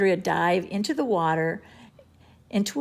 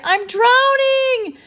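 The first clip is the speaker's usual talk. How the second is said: desperate cry; 9 dB louder, much slower than usual. A narrator's reading. Andrea calling from water.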